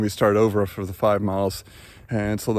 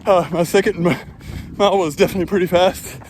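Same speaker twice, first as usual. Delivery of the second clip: breathless, talking mid-run; puffing between phrases on a run; while running; 9 semitones higher than usual. Real, not acted.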